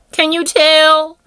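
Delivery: loud, high and drawn-out; emphatic repeat. Real, not acted. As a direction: belt out the question, stretching it slowly.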